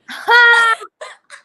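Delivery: high, explosive shriek of laughter; loud burst of laughter. Real, not acted. Reacts to boy's punchline.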